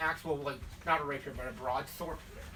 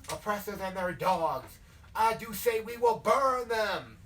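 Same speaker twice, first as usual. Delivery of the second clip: southern hellfire preacher; 4 dB louder than usual. Acted. Bleeding leader vowing to burn them, southern hellfire preaching. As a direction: thunder it out like a revival preacher. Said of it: "like, the hellfire and brimstone thing".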